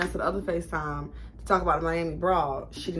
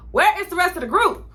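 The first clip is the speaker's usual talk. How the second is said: panicked; 8 semitones higher, 5 dB louder than usual. Retold or imitated. Panicking when the group is gone.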